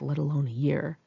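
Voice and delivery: plain reading voice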